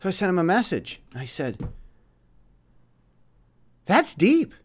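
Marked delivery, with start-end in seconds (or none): 3.9-4.5 high-pitched quoted pronouncement; quoted verdict. Retold or imitated. Speaker retelling the message he sent, high-pitched. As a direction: pitch it well up, as your own quoted reply. Quoting own earlier reply.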